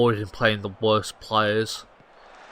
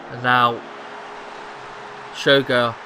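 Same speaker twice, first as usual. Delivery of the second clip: rising, louder call of the scorer's name; excited goal call; 2 semitones higher, 6 dB louder, slower than usual. Real, not acted. Commentating his own match highlight, louder excited call. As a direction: lift the voice as the goal goes in.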